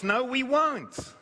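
put-on high, petulant "we won't" like a sulky child; mock childish refusal; stubborn refusal. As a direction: whine the refusal like a stubborn kid. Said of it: "what they were saying".

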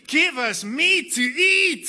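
loud, high, slow whining demand; mock wailing. Moses mimicking the people's wailing, loud and high. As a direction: wail the demand like a spoilt crowd. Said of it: "They keep wailing to me".

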